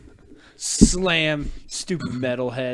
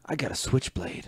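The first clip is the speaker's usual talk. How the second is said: normal talking voice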